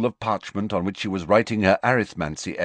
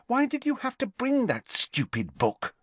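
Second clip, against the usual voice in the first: much higher scolding schoolgirl voice; scolding Hermione. A narrator's reading. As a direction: tell him off, pitching up.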